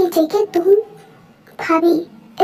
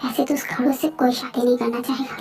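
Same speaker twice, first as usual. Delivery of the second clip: low, soft grumble to herself; muttered aside; 5 semitones lower, 7 dB quieter than usual. Acted. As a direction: drop the voice for a private aside. Muttered aside about the grumpy groom. (धीमी आवाज़ में मन ही मन बड़बड़ाना)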